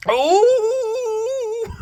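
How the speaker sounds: long, very high, loud wail; hammed-up sustained howl; high wailing cry. Acting out the drawn battle, wailing cry.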